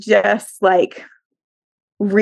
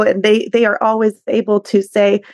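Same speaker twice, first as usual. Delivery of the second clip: ordinary delivery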